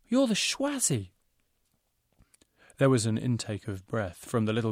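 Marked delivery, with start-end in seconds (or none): 0.1-1.1 awed. A narrator's reading. Awed recognition.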